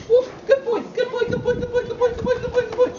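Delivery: very high, loud, rapid praise for a puppy; high-pitched puppy voice. Real, not acted. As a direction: squeal the praise to get the puppy moving. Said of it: "I'm using a high-pitched voice".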